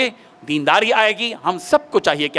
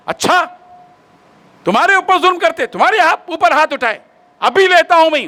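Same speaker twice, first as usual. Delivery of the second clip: high, loud, enraged threat; fly into a rage to defend his mother; furious son; 9 semitones higher, 4 dB louder than usual. Retold or imitated. Son enraged, grabs a hoe. (आग बबूला होकर, ऊँची और तेज़ आवाज़ में)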